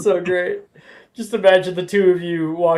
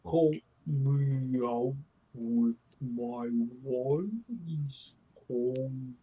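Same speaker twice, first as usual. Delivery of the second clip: slowed-down voice; 5 semitones lower, 12 dB quieter, slower than usual. Retold or imitated. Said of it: "You casted him".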